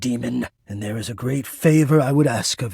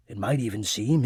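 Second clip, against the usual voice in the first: ordinary talk, nothing marked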